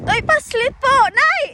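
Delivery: high, loud startled shriek; alarmed scream. Real, not acted. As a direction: yelp in real fright. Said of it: "Pati ako napasigaw".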